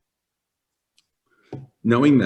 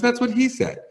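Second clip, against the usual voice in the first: imitating the patient. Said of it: "She goes".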